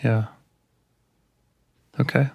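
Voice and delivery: normal talking voice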